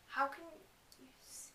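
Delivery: fading to a hush; whispered aside. Real, not acted. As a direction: drop to a whisper.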